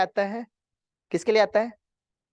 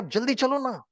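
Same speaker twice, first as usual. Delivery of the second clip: pitched higher, mock-angry hurry; impatient angry retort; 9 semitones higher than usual. Narrator retells urging the driver, mock-angry higher. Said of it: "तो हमको और गुस्सा आया".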